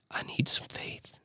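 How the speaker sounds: whispered prayer. Retold or imitated. Mother's retold desperate prayer.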